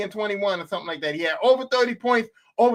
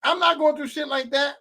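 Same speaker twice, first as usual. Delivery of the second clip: usual speaking voice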